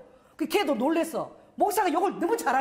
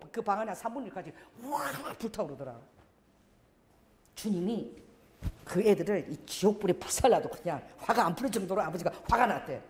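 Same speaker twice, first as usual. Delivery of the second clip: usual speaking voice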